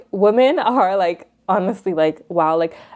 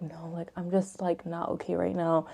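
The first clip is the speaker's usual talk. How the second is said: weak murmur; 13 dB quieter than usual. Retold or imitated. Weak reply to mom.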